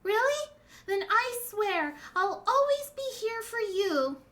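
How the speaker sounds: put-on high, earnest child promise; little daughter's voice. Young Hiyori promising her father, earnest.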